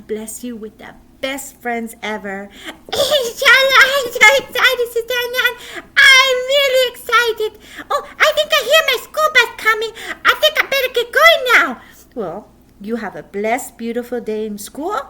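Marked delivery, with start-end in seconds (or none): 2.7-11.8 high, loud, giggling puppet; excited puppet. Acted. Puppet boy hears his bus, high and fast. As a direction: rush off in giggly glee.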